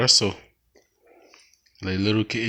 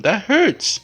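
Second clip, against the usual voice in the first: voicing the kitten; 11 semitones higher than usual. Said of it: "Tell him".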